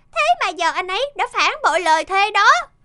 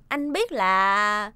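slow knowing hint; 8 semitones lower, much slower than usual. Acted. Hinting at the snoring.

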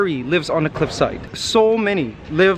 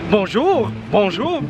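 high, lilting bonjour; Belle-style singsong greeting; 4 semitones higher than usual. Pretend. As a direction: greet like a Disney heroine. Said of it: "Filipino version of Beauty and the Beast".

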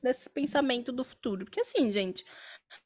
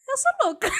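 excited outburst; 12 semitones higher than usual. Real, not acted. Playfully declares herself crazy.

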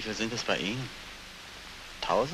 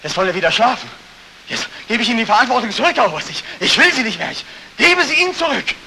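louder and higher, pushing it back at him; loud desperate plea; 9 semitones higher, 11 dB louder than usual. Acted. Veteran desperately returning responsibility to the colonel, loud. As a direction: throw the burden back at him.

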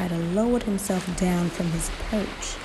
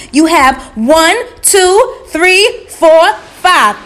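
high, very loud counting; Bro Bull; 10 semitones higher, 16 dB louder, slower than usual. Acted. Bull counting yam hills for the spider, loud and emphatic. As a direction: call out each number at full voice.

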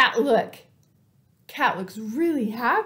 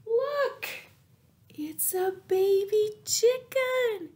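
delighted; 10 semitones higher than usual. A narrator's reading. Delighted reveal of chick.

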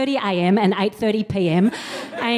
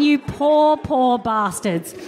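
ordinary talk, nothing marked

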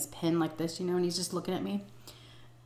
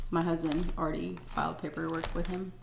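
ordinary talk, nothing marked

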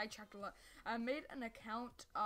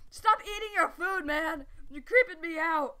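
loud yelling; 7 semitones higher, 13 dB louder than usual. Real, not acted. Yelling at the mob.

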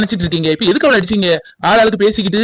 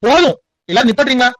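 angry shout; 6 semitones higher than usual. Acted. Brother stops the family quarrel.

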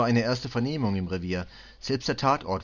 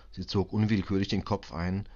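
usual speaking voice

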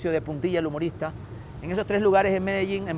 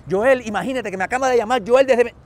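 excited rush; 6 semitones higher, 9 dB louder than usual. Retold or imitated. Ana rushes out with news.

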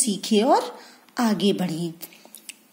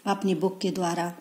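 usual speaking voice

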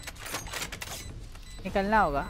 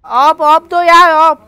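loud, slow and higher; drawn-out pleading shout; 4 semitones higher, 9 dB louder, much slower than usual. Real, not acted. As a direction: stretch it out, demanding the gun. Begging teammate for the Op.

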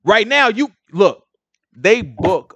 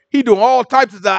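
normal talking voice

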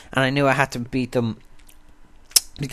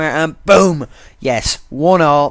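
loud burst of joy at the goal; excited; 6 dB louder, much slower than usual. Real, not acted. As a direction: shout it out, thrilled. Goal scored in penalty shootout.

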